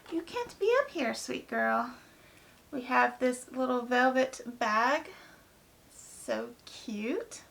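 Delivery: usual speaking voice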